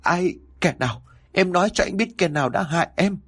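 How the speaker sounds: high demanding cry; a high, mild shout. A narrator's reading. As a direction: demand to know who hurt her. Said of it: "Hải liền hét lớn".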